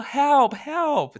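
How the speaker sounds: high, loud cry of help; calling for help. Retold or imitated. Trapped between two doors, calling out loudly for help. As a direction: call out to whoever is outside.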